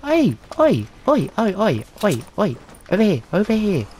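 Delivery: loud high "oi" calls fading down; calling out. Real, not acted. Downed player hollering to his teammates, loud calls. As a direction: shout across the map for attention.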